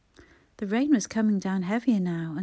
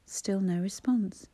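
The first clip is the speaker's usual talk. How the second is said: ordinary delivery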